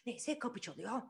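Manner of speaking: ordinary delivery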